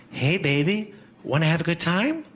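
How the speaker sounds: lightly voiced come-on; a hint of a sultry hooker. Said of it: "my impression of a superhero being propositioned by a hooker".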